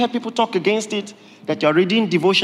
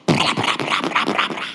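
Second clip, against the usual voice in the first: mock chatter. Mocking endless talk.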